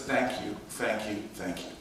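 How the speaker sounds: low, hushed, fading repetition; soft grateful thanks. Retold or imitated. Grateful hug retold in story.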